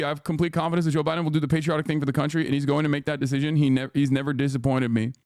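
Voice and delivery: mocking rapid read of a senator's statement; rushed quote. Talker mocking Tim Kaine's statement, rattled off fast. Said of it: "Senator Tim Kaine to".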